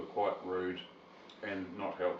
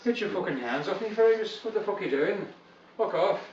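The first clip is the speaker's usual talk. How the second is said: put-on higher-pitched, indignant Scottish accent; 7 semitones higher than usual. Comedian fends off Fergus, in a Scottish accent. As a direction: put on a riled Scotsman, pitched up. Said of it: "I just got this Scottish accent".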